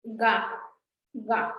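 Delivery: "ga" with a voiced hard g; "ga" as in gato, clearly voiced; ga syllable demo. Shown as a demonstration. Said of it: "Es diferente ka que ga".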